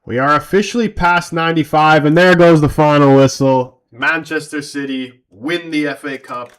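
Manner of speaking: loud final whistle call; excited announcement. Real, not acted. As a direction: announce it like a commentator.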